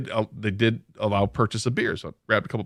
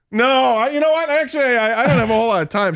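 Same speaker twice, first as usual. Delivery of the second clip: high, louder awkward backpedal; flustered refusal; 11 semitones higher, 4 dB louder than usual. Retold or imitated. Guest declining food from the woman who busted him, flustered. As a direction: stumble into a pitched-up excuse.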